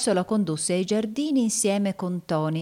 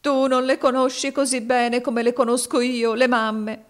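high, loud tearful protest; upset child, voice pushed up; sobbing boy; 8 semitones higher, 6 dB louder than usual. A narrator's reading. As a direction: insist through acted sobs. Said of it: "un grosso singhiozzo lo interruppe".

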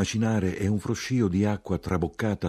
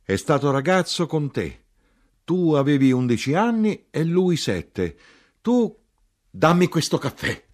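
high, loud, agitated outburst; heated cry, pitched far up; shouting; 7 semitones higher, 5 dB louder than usual. A narrator's reading. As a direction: break off into a shouted demand.

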